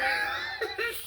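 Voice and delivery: high shrieking laugh. Real, not acted. Laughing at scaring herself, high shriek. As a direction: burst into a squealing laugh.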